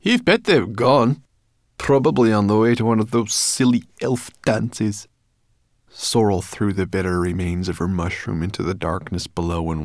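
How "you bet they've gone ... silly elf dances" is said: higher, brisk brownie voice; a cheeky, know-it-all forest creature. A narrator's reading. Sorrel voiced by narrator.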